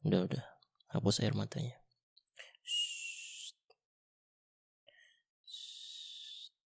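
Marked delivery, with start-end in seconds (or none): 2.6-6.5 long gentle shush; tender soothing shh; soft shushing. Acted. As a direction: hush her like a child. Hushing the crying listener.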